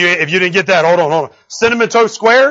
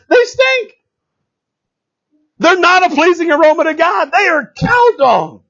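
preaching at full force; 9 semitones higher than usual. Real, not acted. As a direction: preach it hard and rising. Preaching climax on substitutes.

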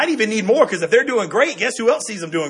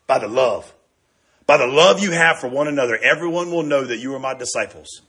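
Jesus speaking; 7 semitones lower than usual. Retold or imitated. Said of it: "He looks at his disciples and says".

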